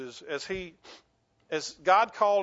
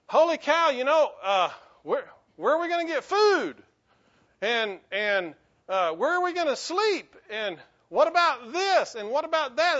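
panicked Israelites; 7 semitones higher, 5 dB louder than usual. Retold or imitated. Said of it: "They're like".

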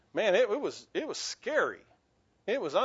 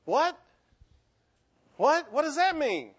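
alarmed surprise; 8 semitones higher, 3 dB louder than usual. Retold or imitated. Mock alarm at the recording.

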